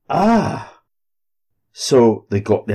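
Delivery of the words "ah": high startled ah; shocked gasp. A narrator's reading. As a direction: gasp in fright.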